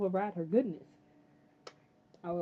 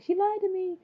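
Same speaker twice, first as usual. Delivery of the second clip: high, loud mock wail of accusation; upset boy; 13 semitones higher, 7 dB louder than usual. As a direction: cry it out like a wronged kid. Acting upset Henry in recap.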